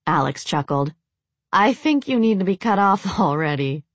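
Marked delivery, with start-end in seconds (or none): 1.5-3.8 teasing line with a snicker. Acted. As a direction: tease him through a snicker. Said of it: "Jocelyn snickered".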